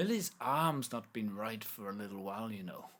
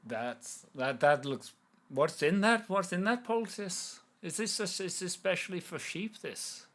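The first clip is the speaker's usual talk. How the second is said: put-on Irish accent; 4 semitones higher, 4 dB louder than usual. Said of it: "which part of Ireland my accent's coming from".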